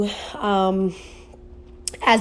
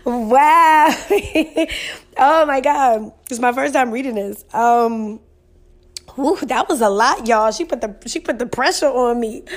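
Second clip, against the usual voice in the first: higher, overwhelmed and nearly tearful; tearing up; 3 semitones higher than usual. Real, not acted. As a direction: try to collect yourself after being moved. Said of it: "I was just tearing up".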